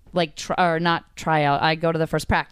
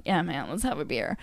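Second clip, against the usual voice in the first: hushed agreement; 3 semitones lower, 8 dB quieter than usual. Retold or imitated. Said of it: "And I was like".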